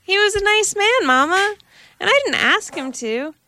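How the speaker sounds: high, eager little kitten confessing; innocent kitten. A narrator's reading. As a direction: explain sweetly to your mother. Kitten defends the man.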